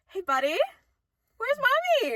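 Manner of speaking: put-on high, sweet voice for a little kid; talking to a kid. Worker asking lost child, high sweet coaxing. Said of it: "And I'm just like".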